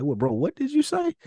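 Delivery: high confused "what did you say"; puzzled listener. Retold or imitated. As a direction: play someone straining to catch slang. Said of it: "you ask, like".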